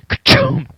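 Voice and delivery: short percussive chop noise; imitated hatchet blows. Player retelling the attack on the door, punchy thud noises. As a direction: mimic a blade thudding into wood. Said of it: "And then I hear".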